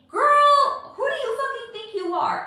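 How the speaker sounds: put-on loud, high-pitched "girl" telling herself off; mock scolding. Streamer scolding herself for being entitled, mock outrage.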